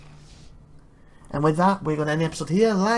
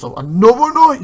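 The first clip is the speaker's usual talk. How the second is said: much louder and higher booming call; hearty bellow; 9 semitones higher, 12 dB louder than usual. A narrator's reading. Knight hailing a fellow knight, loud bellow. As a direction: bellow it out in full voice. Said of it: "he bellows".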